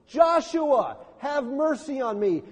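"Joshua" called out high and loud; crying out. Retold or imitated. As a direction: cry out "Joshua" across the gate. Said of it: "he cries at the gates".

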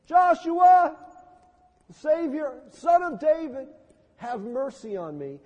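"Joshua" shouted very high and loud; desperate cry. Retold or imitated. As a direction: cry over the hushing crowd. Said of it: "he cries at the gates".

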